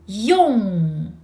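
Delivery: Yong with lips rounded, nasal back ending. Shown as a demonstration. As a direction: shape the lips beautifully on Yong. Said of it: "Nasal final in the back".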